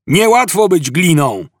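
high-pitched complaining call; shout. A narrator's reading. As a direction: call it out from a distance. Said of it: "Po chwili rozległ się okrzyk". (podniesiony, żałosny okrzyk)

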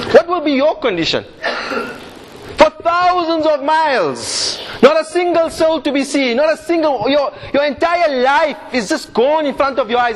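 stirring preacher building excitement; impassioned preaching. Acted. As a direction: paint the scene for the crowd with rising passion. Passionate storytelling sermon.

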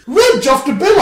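loud, high call across the room; excited shout. Acted. Spotting an old school friend, shouting out loud. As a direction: yell his name on recognizing him. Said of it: "I shouted".

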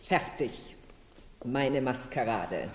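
soft, slow, half-whispered; hushed reveal. Acted. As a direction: present the costume in a hush. Marquise reveals her costume.

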